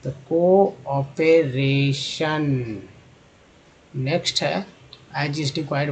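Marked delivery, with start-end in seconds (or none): 0.1-2.8 कोऑपरेशन drawn out, को- clearly first; कोऑपरेशन slow and careful; slow clear word. Shown as a demonstration. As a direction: spell out कोऑपरेशन in sound. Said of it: "सीओ को बोलते हैं".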